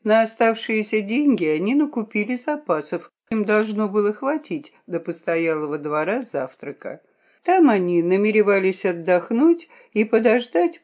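usual speaking voice

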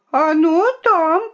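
high, loud taunting cry; shout. Acted. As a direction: shout it out like a spoilt girl. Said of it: "крикнула Виолинка".

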